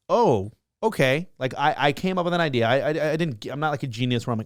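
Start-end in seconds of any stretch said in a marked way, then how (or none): 0.1-1.3 stunned surprise. Retold or imitated. Reacting to fifteen million views.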